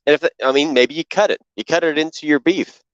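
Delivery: normal talking voice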